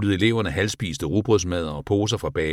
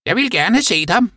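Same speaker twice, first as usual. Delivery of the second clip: high, loud yapping voice; barking dog; 5 dB louder than usual. A narrator's reading. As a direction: yap the words like an eager dog. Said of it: "gøede Toffee". (lyst gøende hundestemme)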